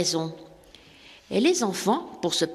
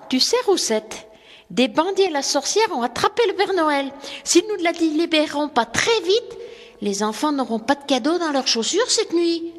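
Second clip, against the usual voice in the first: put-on much higher, excited young chimney sweep; eager young sweep; 7 semitones higher than usual. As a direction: answer the children with bright eager surprise. François explains to his cow.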